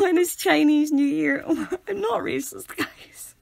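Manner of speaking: high, laughing through the words; embarrassed and cracking up; loud, giggly self-defence; laughing protest. Real, not acted.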